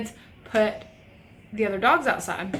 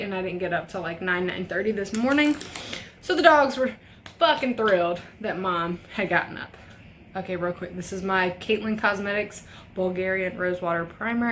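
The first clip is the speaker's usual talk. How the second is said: usual speaking voice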